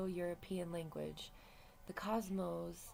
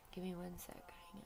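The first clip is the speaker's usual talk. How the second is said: whispering; 8 dB quieter than usual. Real, not acted. Aside while pausing recording.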